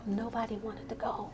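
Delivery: quiet, deflated punchline; hushed admission. Real, not acted. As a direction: say it small, under your voice. Repeats it for comic effect.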